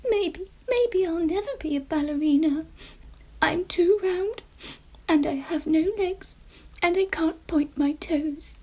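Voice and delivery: a sad pumpkin giving up hope; tearful. Acted. Jemima cries over her dream, high and tearful. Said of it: "she sniffed some more".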